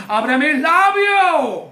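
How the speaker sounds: drawn-out cry to God; slow, fervent preacher's shout; shouted plea. Shown as a demonstration. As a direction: cry out to the Lord, stretching it. Repeats prayer as a loud cry.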